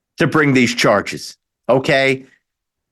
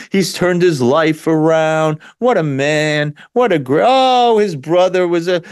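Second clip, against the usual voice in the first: milk the sob story with fake pity; mock sympathy. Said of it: "I'm not mocking Beau Biden by any means".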